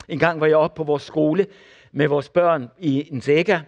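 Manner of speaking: normal talking voice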